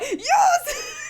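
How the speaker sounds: high delighted shout of yes; excited shout. Real, not acted. Fan cheering yes at the anime's payoff. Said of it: "that was more me".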